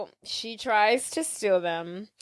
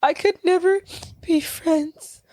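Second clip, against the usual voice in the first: high, halting acted hurt; slow, pained mock rejection; forced rejection; 6 semitones higher than usual. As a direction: force it out in pieces, pitched up. Ella forced to reject best friend.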